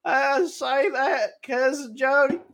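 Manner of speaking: high, slow Southern twang; squeaky-high hillbilly drawl; hammed-up country accent; mock country cousin.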